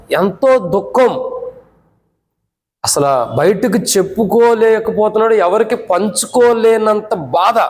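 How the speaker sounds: high, drawn-out words of anguish; anguished preaching. Real, not acted. Preacher describing pain too great to share, anguished preaching.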